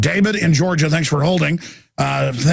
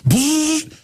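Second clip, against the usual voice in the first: mock blender buzz; 10 semitones higher than usual. Blender noise in mock rant.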